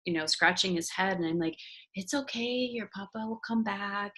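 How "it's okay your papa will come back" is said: put-on soft, gentle comforting of a child; retold soothing. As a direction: softly reassure a tearful little one.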